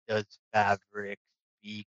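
slow, booming dragon. Acted. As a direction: speak as an ancient proud dragon. GM voicing the dragon.

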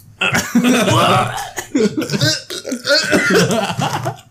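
long, high burst of laughter; high cackling laugh; loud laughter. Real, not acted. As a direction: crack up loudly. Game ends, burst of laughter.

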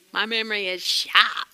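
New England "sharp" with the r dropped; New England accent. Real, not acted. Pastor joking about own memory, New England accent. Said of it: "That's my New England accent".

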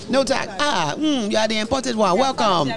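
high-pitched mock Nigerian remarks; imitated Nigerian accent. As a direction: mimic blunt Nigerian relatives. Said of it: "Nigerians would just say".

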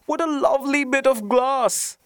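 put-on very high little-girl voice; little girl's delighted cry. A narrator's reading. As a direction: exclaim in delight like a small child. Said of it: "cried the little girl".

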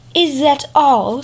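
slow, sneering question; a lightly voiced proud fox scoffing; scornful fox. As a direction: drag it out, unimpressed. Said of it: "I am a master of a hundred arts".

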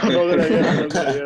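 laughing no, no, loud and up. Real, not acted. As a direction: laugh the denial out. Laughing denial of a friend's claim.

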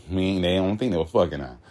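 ordinary delivery

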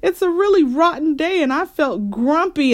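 put-on high, whiny child's excuse; protesting. A narrator's reading. Sister excusing her rudeness to Mama, protesting. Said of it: "protested Sister".

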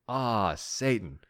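long, drawn-out groaning sigh of recognition; exasperated self-mockery, drawn right out; mock realization. Catching himself out.

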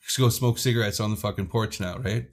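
usual speaking voice

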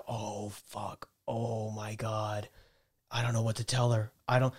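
very soft, low inner panic; hushed dread. Retold or imitated. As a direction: think it under your breath, dreading it. Retold silent panic on the kayak.

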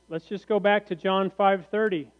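normal talking voice

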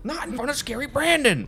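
high, loud mock protest; mock squeal. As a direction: squeal it like scandalised.

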